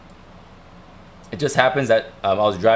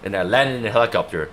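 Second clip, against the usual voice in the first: normal talking voice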